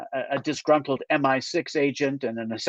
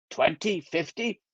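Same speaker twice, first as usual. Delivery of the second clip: slow, questioning Scottish echo; drawn-out disbelieving repeat; incredulous echo; 3 semitones higher, 4 dB quieter than usual. Acted. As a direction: tease him for the military time. Angus mocks the military time.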